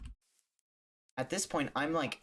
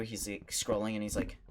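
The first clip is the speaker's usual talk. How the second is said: ordinary delivery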